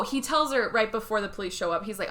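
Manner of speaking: normal talking voice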